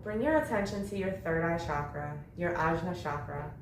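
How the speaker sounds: ordinary talk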